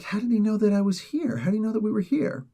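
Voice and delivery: high-pitched mock disbelief; baffled complaint pitched well up; acted bafflement. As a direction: put on a high, incredulous voice. Villagers' ambush, acted baffled complaint.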